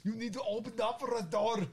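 put-on high-pitched action-hero accent; hammed-up urgent order; Arnold character voice. Druid ordering a door opened, Arnold impression. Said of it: "in that accent for that voice".

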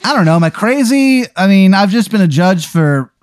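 put-on high, wavering old judge; silly judge voice. Judge asks the court if he is crazy, high and silly. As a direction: play the judge as a doubting old man in a high voice.

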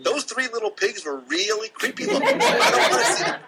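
laughing while speaking, voice pitching up into a giggle. Real, not acted. Laughing to the host about creepy pigs. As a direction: laugh through the words.

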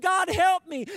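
high, loud cry for help; screamed plea. Acted. Man hanging from a cliff screams up to God. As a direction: call desperately up to the heavens. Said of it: "and screamed".

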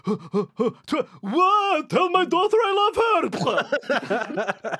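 high, slow, hammed-up dying cry; dying vampire wail. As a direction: wail it as you tumble down. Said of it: "it sounded a bit like this".

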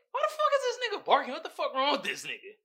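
quick, high, incredulous yelp; high-pitched disbelief, fast; baffled outburst. Retold or imitated. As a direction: act out being totally thrown by it. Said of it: "I'm like".

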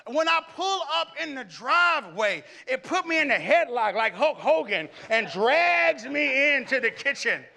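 loud, high comic exaggeration; hammed-up rave. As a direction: play the helpless foodie for laughs. Comic rave about cooking.

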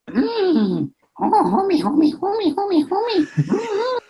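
high cartoon housewife voice, drawn-out homie; imitating a cartoon mom calling her husband, high; Marge Simpson impression. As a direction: sing out homie like a fond cartoon wife. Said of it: "That Marge impression is spot on".

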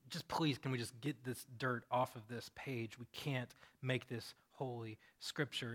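low, soft, weary plea; slowing, subdued appeal; quiet pleading. Acted. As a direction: plead under your breath. Scribe's weary pleading thought.

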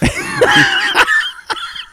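loud high shriek of laughter; burst of laughter. Real, not acted. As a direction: cackle at your own blunder. Cracks up at own misreading.

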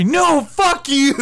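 high, loud, drawn-out fake wail; mock tearful outburst. Embarrassed stranger rejecting an apology, mock tearful shouting. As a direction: refuse the apology in an over-the-top crying yell. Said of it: "He starts crying and he goes".

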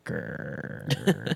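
soft unvoiced mock growl. At the host's nippy joke, soft mock growl. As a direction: growl at him in jest. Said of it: "I'm like".

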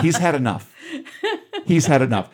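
laughing through the words. Real, not acted. Host laughing as the chief walks out of the wedding, amused.

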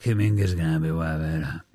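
low, quiet mock confidence; mock Warriors bosses. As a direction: drop low and smug as a clueless front office. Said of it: "They're like".

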